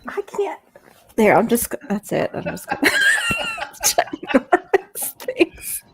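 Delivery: laughing through the words; giggling fit, words breaking up. Real, not acted. Laughing fit after burping.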